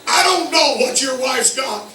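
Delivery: high, loud building sermon cry; rising preacher's fervor; preaching at full force. Acted. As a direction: drive it home to the congregation.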